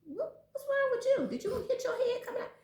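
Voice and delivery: hammed-up startled mother squeak; mock shocked mom. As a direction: pitch it way up in mock disbelief at the child. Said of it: "I'd be like".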